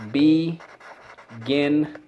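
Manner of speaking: "Beginner" very slow, be, gin, ner with long gaps; syllable by syllable. Shown as a demonstration. Teacher repeats drill with class, slowly syllable by syllable. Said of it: "Let's go".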